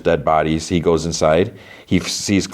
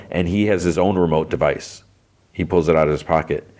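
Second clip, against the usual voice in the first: usual speaking voice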